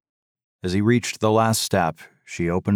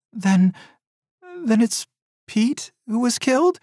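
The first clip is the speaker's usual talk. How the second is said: gasping. A narrator's reading. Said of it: "she gasped".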